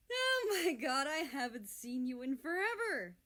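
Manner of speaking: high, loud, delighted burst; thrilled shout of reunion; excited exclaim. Acted. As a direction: gush with joy at an old friend. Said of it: "America exclaims".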